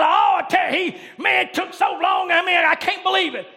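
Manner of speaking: mock complaint about a long service; hammed-up griping after church; grumbling churchgoer. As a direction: whine loudly about the sermon length. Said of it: "That's the kind of attitude".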